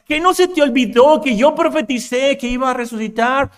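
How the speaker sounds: high, loud, exasperated reprimand; mock scolding shout. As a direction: berate her impatiently, stretching the ends of phrases. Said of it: "no le gritó".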